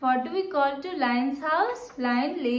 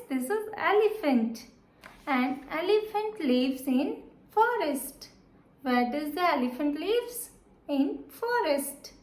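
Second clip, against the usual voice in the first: ordinary talk, nothing marked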